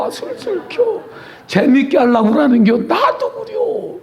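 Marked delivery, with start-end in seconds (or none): 0.1-1.0 high, soft coaxing imitation of a husband. 2.8-4.0 high, slow put-on reply, hammed-up; drawn-out, high teasing "me too"; mock agreeing voice. Playful mimic reply.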